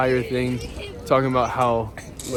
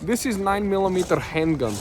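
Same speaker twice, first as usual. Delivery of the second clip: mock Russian accent; 7 semitones higher, 4 dB louder than usual. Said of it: "We were like".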